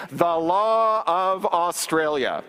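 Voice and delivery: very slowly, each word spelled out; slow emphatic reading. Shown as a demonstration. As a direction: read it out slowly for the audience to join in. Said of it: "read it with me".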